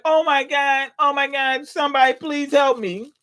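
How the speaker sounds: high-pitched panicked plea; scared begging. Retold or imitated. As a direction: play the terrified boss crying for help. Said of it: "now he's standing there scared".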